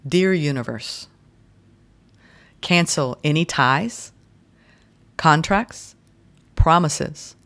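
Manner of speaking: slow declaration with long pauses between phrases; slow, for writing down. Real, not acted. Reader leading listeners in a declaration to repeat, said slowly. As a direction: leave room for listeners to repeat each phrase. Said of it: "I'll say this slowly".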